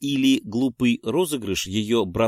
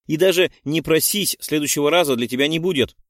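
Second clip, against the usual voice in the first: heated retort; 5 semitones higher, 5 dB louder than usual. A narrator's reading. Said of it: "запальчиво добавила Пенелопа".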